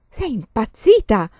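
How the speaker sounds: loud, slow exclamation of disbelief; indignant outburst. A narrator's reading. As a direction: scold someone who has lost her mind. Rimprovero alla donna col fucile. (esclamazione indignata)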